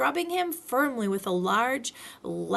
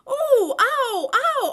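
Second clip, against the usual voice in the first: loud high repeated ow; bumping yelps all the way; squeaky pained yelps while bouncing; Piglet's yelps; 7 dB louder, much slower than usual. A narrator's reading.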